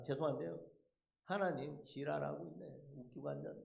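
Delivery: low, soft contemptuous aside; muttered insult. Retold or imitated. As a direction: drop low and quiet for the retold jab. Throws bottle, parting insult to God. (낮고 조용하게 내뱉는 욕)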